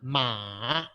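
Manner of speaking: "Ma" low, dipping down then up; dipping third tone. Shown as a demonstration. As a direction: let "Ma" sink and rise. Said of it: "start with the tone going down and then going up".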